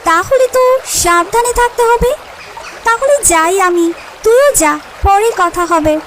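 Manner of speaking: lightly voiced friendly goat; a hint of a chummy goat. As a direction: call out casually like a goat friend. Goat reacts to warning.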